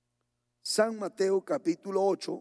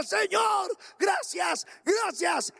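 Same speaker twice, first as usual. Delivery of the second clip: shouting thanks; 12 semitones higher, much slower than usual. Retold or imitated. Healed man in bed shouting thanks to God. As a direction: yell your thanks to the Lord.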